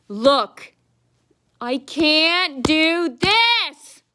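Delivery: high, loud, very slow mocking whine; mocking whiny clerk. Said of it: "she goes".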